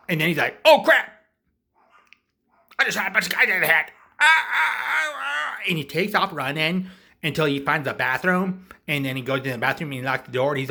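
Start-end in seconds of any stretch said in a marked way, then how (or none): 0.6-5.6 high, loud freak-out ending in wails; mock panic. Clown realising he shot guys, loud high mock panic. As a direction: panic out loud, breaking into yelps. Shooter panics after killing.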